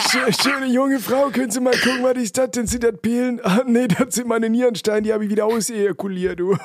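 pensioner voice. Acted. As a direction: play a leering old pensioner. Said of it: "den Rentner mag ich auch gerne".

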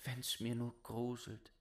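softly wishing to himself; muttering. Acted. Boy walking along, talking to himself. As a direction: say it under your breath. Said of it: "sprach immer vor sich hin".

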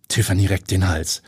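ordinary delivery, nothing marked

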